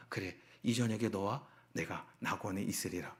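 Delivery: low, soft voice of Jesus; lightly voiced promise, hushed and low; solemn Lord's promise. Jesus answering the robber on the cross, low solemn promise. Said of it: "그 한 편 강도가 주님께 이야기합니다".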